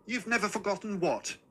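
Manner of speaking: mother's stern, high-pitched question; suspicious, disapproving query. A narrator's reading. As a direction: demand it sternly, pitched well up. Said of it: "my mother asked sternly".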